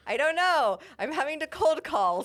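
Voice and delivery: high, loud, laughing defensiveness; mock protest. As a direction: protest it up high, half amused. Defending her phone voice, retold.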